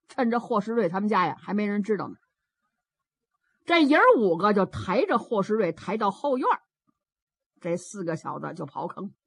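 ordinary delivery

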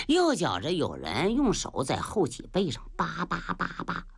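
usual speaking voice

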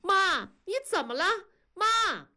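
high, frantic call; crying out. A narrator's reading. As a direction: call out to a collapsing mother, alarmed. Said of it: "大声地喊".

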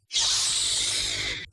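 breathy whooshing sound effect, unvoiced; voiceless rushing whoosh; whoosh of a swung hammer. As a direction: blow out a sharp swish like a flying hammer. Hammer whooshing through air.

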